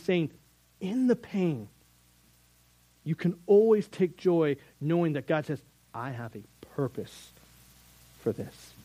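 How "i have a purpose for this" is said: soft, near-whispered promise from God; hushed voice of God. Acted. Preacher voicing God to the congregation, hushed and reassuring. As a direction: drop to a hush, reassuring.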